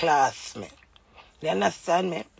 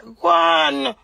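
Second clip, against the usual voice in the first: high, loud furious bark; angry shout; 9 semitones higher, 7 dB louder than usual. Real, not acted. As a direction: blast it at him, fed up. Furious rant at husband.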